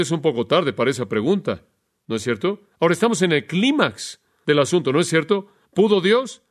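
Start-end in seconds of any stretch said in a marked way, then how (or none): none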